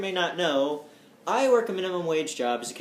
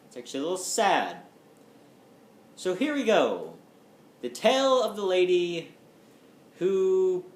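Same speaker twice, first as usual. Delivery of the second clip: ordinary talk, nothing marked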